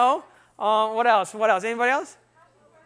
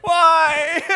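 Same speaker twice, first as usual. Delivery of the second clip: mock sad wail; 7 semitones higher, 5 dB louder than usual. Shown as a demonstration. Acts out sad jumps.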